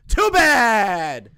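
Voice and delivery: loud and defiant, slowing on the last line; loud, mocking brush-off to chat; defiant shout. Real, not acted. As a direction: shout down the chat's complaint. Snapping back at chat critics.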